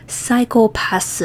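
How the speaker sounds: slow, mock Japanese-accented "Psychopath". Shown as a demonstration. Complaining about the translator's version, mock Japanese accent. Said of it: "racist version of psychopath".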